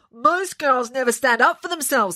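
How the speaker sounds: defiant with a stuffed nose; blocked nose voice. Acted. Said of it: "She sounded very nasal".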